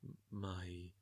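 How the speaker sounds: imitating a stilted actor, low and quiet; halting attempt at a stretched-out line; soft, low mock voice-acting; drawn-out bad dub. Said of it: "it's just really long, drawn out syllables".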